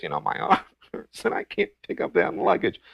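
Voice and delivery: imitated tearful whine, pitched high; high, put-on weepy complaint; mock crying. Man acting tearful to a helper by his car, mock crying. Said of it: "he's going on like this".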